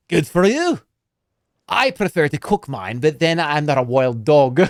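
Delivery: put-on gruff dwarf in a hammy Scottish accent; dwarf on the plain, suspicious of the stranger, bad Scottish accent. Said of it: "that's a bad Scottish accent".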